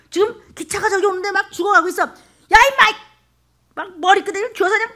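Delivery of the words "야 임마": much higher, loud yell grabbing someone; sharp yell. Acted. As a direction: bark it to stop him. Yelling at man on tracks.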